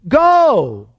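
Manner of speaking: high, drawn-out "go"; emphatic command. Retold or imitated. Preacher quoting the Great Commission, emphatic command.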